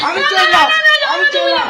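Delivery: high, sulky walking-out; huffy storming off. Acted. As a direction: leave in a mock huff. Storming off in a huff. (অভিমানে রাগ করে চলে যাওয়া)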